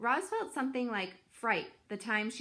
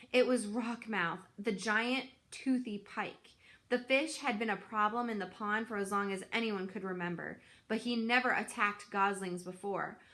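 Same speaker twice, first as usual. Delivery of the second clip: ordinary delivery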